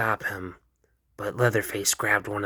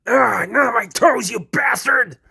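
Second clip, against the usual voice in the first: much higher, loud, hurt yell; pained shout; 13 semitones higher, 8 dB louder than usual. Acted. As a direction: yell in pain. Leatherface hurt in fight.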